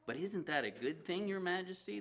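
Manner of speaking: a hint of a humble servant; servant's question. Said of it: "the servant asked".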